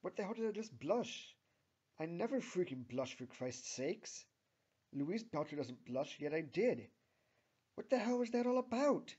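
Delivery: high, loud, flustered inner outburst; frustrated. A narrator's reading. Louise scolds herself for blushing, frustrated. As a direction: build to an exasperated, high-pitched demand. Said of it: "thinks Louise herself frustratingly".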